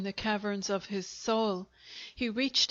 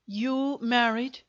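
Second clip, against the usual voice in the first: slow, disbelieving question; acted demand, stretched with disbelief; incredulous demand; 2 semitones higher, 4 dB louder, slower than usual. A narrator's reading. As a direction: draw it out in mild astonishment. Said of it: "he demanded".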